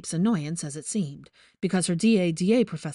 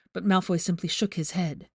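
usual speaking voice

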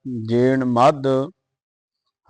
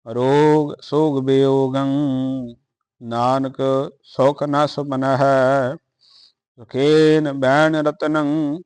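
measured, chanted Gurbani reading; steady devotional recitation; scripture recitation. Acted.

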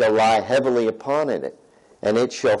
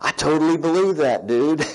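laid-back guy; 5 semitones higher than usual. Retold or imitated. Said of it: "he was just like".

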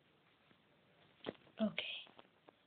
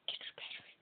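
fading to a whisper; 9 dB quieter, faster than usual. Shown as a demonstration. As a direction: repeat it, dropping to a whisper. Repeats the goal, fading to a whisper.

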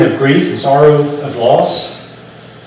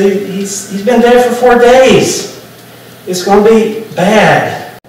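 high, slow, mock-aghast warning; dismayed protest; 5 semitones higher, slower than usual. Martha warning Jesus at her brother's tomb, acted dismayed protest. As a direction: plead higher, stretching out the bad news.

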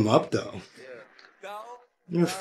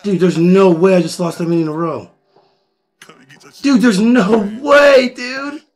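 a real, rising yell, slowed and stretched out on the second "no way"; furious shout; 8 semitones higher, 12 dB louder, slower than usual. Real, not acted. Streamer raging at losing many keno rounds in a row, furious shout. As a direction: bellow it in disbelief at the screen.

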